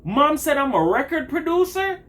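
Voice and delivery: sarcastic high-pitched realization; mock realization. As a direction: act like it all just clicked, sneering. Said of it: "I said".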